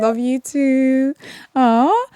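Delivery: slow, drawn-out love you too with a lift on "too"; a slow, lifted "Love you too" back to the caller; affectionate reply. Real, not acted. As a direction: stretch "too" out fondly. Host answering a caller's love.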